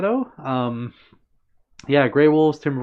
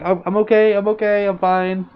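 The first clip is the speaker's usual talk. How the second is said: hasty reassurance; 7 semitones higher, 4 dB louder than usual. Retold or imitated. Retells reassuring crowd after falling.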